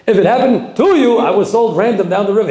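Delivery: impassioned preaching. Acted. Preacher rising to a peak.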